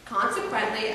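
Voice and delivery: normal talking voice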